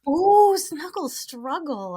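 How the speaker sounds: high, slow, savoring ooh; delighted. Real, not acted. Hearing the co-host's favorite term, delighted.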